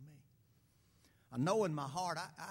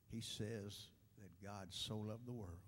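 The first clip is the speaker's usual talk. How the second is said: ordinary talk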